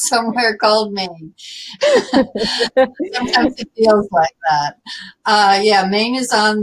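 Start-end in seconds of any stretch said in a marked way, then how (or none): 1.8-4.8 slow, through laughter; laughing. Real, not acted. Guest joking to host about home state, laughing. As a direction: let the laugh run into the words.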